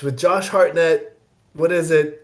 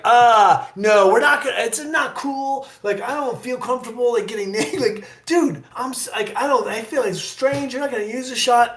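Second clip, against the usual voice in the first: pitched-up, uncomfortable objection; retold pleading to get out of it; reluctant protest; 7 semitones higher than usual. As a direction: squirm and object in a higher voice. Retelling reluctant pushback to director.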